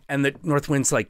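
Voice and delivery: ordinary delivery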